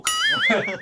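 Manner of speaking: high squealing laugh; a short incredulous laugh; burst of laughter. Real, not acted. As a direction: laugh out in disbelief. Laughs at the film.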